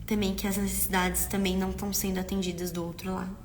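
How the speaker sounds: normal talking voice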